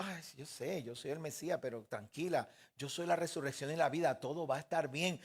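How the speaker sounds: softly acted calming voice; calm her down gently, keeping it soft; soothing reassurance. Yeshua comforts the grieving woman, soothing reassurance.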